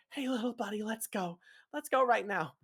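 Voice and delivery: high, hushed hurrying; urging Hap. Acted. Hap hurrying a little buddy away, urging. Hap urges companion to leave.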